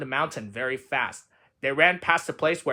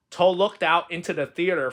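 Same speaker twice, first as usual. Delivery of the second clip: usual speaking voice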